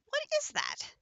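high, thin mock-frightened question; squeaky startled retelling. Retelling the wraith fright, high squeaky surprise. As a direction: squeak it like you just got spooked. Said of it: "I'm like".

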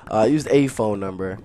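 ordinary delivery

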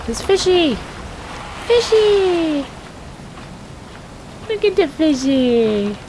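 high cutesy cooing; baby talk. Pretend. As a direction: coo at the fish like a toddler. Delighted by fish mid-panic.

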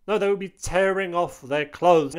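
usual speaking voice

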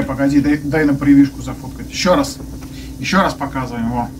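ordinary talk, nothing marked

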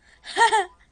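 loud, high laugh bursting out; real loud giggle; burst of laughter. Real, not acted. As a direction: laugh hard at the mess. Laughs at the glitch.

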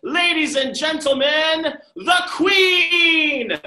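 high, loud, slow fanfare announcement; announcer. Acted. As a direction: herald the Queen to the crowd. Announcing the Queen.

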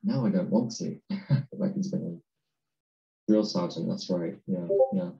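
usual speaking voice

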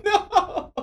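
high shocked cry. Real, not acted. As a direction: cry out in dismay. Sudden loss in game.

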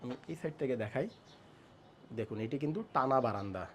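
hushed tour narration; speaking softly. Real, not acted. Host showing balcony near sleeping baby, speaking softly. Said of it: "আস্তে আস্তে কথা বলছি".

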